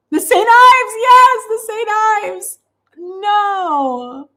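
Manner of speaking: high, loud, thrilled shriek of recognition; squealing with delight over a remembered brand; excited recognition. Real, not acted. Excited recognition of old product.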